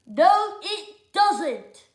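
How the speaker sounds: put-on loud, high child's "no"; a stubborn boy shouting her down; bossy refusal. George refusing Lindy, loud and high.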